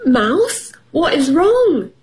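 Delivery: high, concerned storybook rabbit; storybook animal asking what's up; Rabbit's voice. A narrator's reading. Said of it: "said Rabbit".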